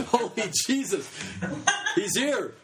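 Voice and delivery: high, laughing gasp of amazement; laughing excitement. Retold or imitated. Leader laughing as Jesus visits the church. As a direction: blurt it out through a laugh, starstruck.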